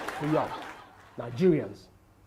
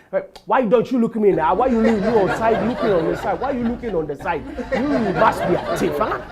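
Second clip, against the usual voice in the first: loud hammed-up accusation; accusing woman; 6 dB louder than usual. Woman in elevator presses him, loud mock anger. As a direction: bark the accusation like a bossy woman.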